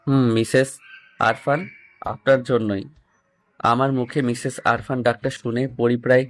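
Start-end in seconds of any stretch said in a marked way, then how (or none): none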